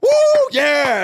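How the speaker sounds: loud high whoop; excited cheer. Retold or imitated. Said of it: "I was like".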